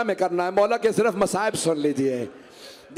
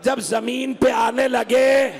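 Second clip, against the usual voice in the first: preaching at full force; 4 semitones higher, slower than usual. Acted. Preacher describing Hussain's fall, slow building lament. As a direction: build the lament slowly toward the chant.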